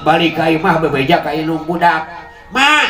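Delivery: ordinary talk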